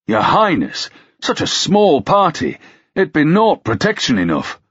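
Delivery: high-pitched indignant protest; outrage. A narrator's reading. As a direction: object with mounting outrage, pitch jumping up. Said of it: "Ruck asked in outrage".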